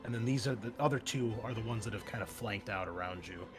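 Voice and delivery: ordinary talk, nothing marked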